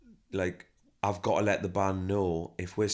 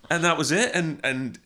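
laughing, louder and higher; 8 semitones higher, 7 dB louder than usual. Real, not acted. Laughing with interviewer.

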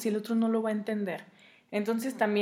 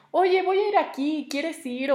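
higher, louder, bright casual call; higher, louder easy invitation to a partner; 6 semitones higher, 3 dB louder than usual. Retold or imitated. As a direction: toss it lightly to your partner, much higher. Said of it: "le dices".

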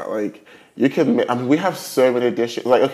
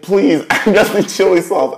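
laughing plea; 8 semitones higher, 6 dB louder than usual. Retold or imitated. Retelling her plea.

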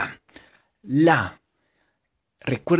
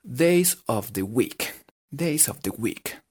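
slow, posh "days of the week"; posh English; much slower than usual. Shown as a demonstration. Teacher says it with full gomelería, slowly. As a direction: say it like a snob, slowly. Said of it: "con toda la gomelería que tengan".

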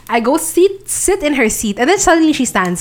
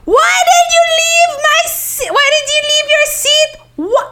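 much higher tipsy screech; mock scream; slower than usual. Screaming at the friend who left her seat, tipsy wail. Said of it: "I started screaming at her".

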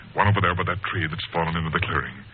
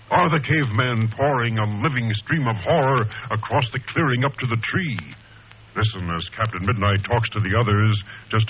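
radio serial narration; 5 semitones higher, 3 dB louder than usual. Acted. Announcer asks listeners about the villain, dramatic narration.

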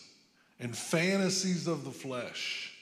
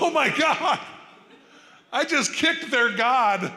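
panicked thought; 11 semitones higher, 9 dB louder, slower than usual. Retold or imitated. Panicked inner thought after kicking Buddha.

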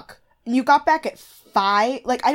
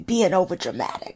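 lightly imitated reality-show contestant; Brie; 2 semitones lower, 4 dB quieter than usual. Co-host corrects the impression, lightly imitated castmate voice. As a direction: echo it in another contestant's voice, a bit softer. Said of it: "No, that's Brie".

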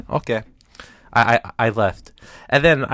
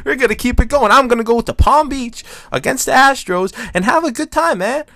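hyped; 12 semitones higher, 6 dB louder than usual. Retold or imitated. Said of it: "And I was like".

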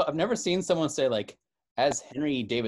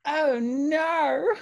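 "Oh, no" shrieked much higher with an added R; shrieked British oh no; 12 semitones higher, 5 dB louder than usual. Retold or imitated. Host shrieking the British "Oh, no" as a joke. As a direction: shriek a wailing "Oh, no". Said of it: "they say".

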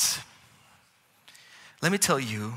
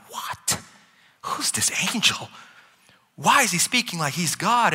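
acted baffled reaction, higher pitch; puzzled disbelief; 6 semitones higher than usual. Preacher voices readers puzzled by the angel, in mock disbelief. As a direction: question it in mock bewilderment.

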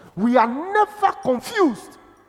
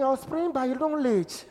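normal talking voice

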